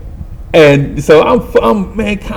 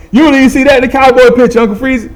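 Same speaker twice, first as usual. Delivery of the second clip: very high, loud, rapid call; performed catchphrase; 12 semitones higher, 4 dB louder, faster than usual. Acted. Repeating the saying to his uncle, high and loud. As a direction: throw it out high and fast.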